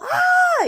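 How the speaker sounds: high, loud shriek of fright; sudden piercing yelp, acted out; retold startled scream. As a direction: shriek as if something just lunged at you. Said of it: "And I was all".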